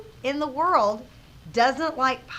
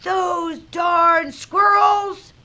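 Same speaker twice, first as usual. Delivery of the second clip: high, loud, drawn-out shout; slow, furious storybook holler; angry yell; 7 semitones higher, 8 dB louder, much slower than usual. A narrator's reading. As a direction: yell it like a grumpy old man shaking his fist. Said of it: "shook his old man fist and yelled".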